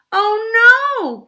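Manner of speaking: high, loud alarmed cry; exclaimed. Acted. Goldie cries out as the chair crashes. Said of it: "Goldie exclaimed".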